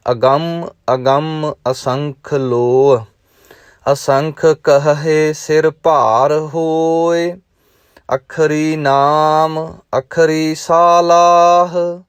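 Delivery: slow, measured chanting recitation; gurbani recitation. Acted. Teacher reciting Japji Sahib to students, measured chant. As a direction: intone each line with devotion.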